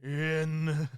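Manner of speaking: drawn-out words with long pauses, swelling loud at the end; mock doom catchphrase. Cohost mimicking a wrestler's catchphrase, slow theatrical proclamation. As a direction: intone each word separately, booming the last.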